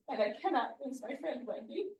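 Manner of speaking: ordinary talk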